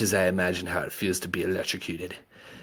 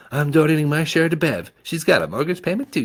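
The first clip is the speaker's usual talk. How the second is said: woman voice; 8 semitones higher, 7 dB louder, faster than usual. Acted. Miss Lewis voiced.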